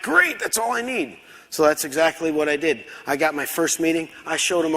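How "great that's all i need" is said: high, eager yelp of thanks; acted-out overjoyed reply; delighted acceptance. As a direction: jump at the offer, pitch soaring.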